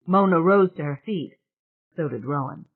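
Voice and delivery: usual speaking voice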